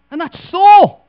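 sudden loud high shout of the name; preacher's shout. Acted. Preacher booming the answer to his congregation, shouting. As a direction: throw the punchline out at full volume.

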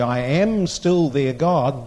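slow, with "am" drawn out; weight on "am", unhurried; stress on AM. Shown as a demonstration. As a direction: hold "am" before moving on to "still". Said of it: "when you read the Scriptures correctly".

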